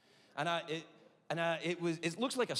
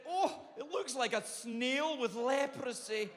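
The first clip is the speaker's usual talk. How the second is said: doctor voice; 9 semitones higher, slower than usual. Retold or imitated. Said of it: "she went".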